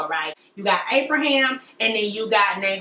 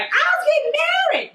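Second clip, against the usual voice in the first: squealed, very high; high excited Rebecca; faster than usual. Acted. Rebecca running home to tell her folks, squealing high.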